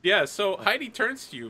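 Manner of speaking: normal talking voice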